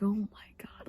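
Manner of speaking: fascinated by trees, whispered awe; awed whisper. Retold or imitated. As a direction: breathe it out in wonder. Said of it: "I was just like".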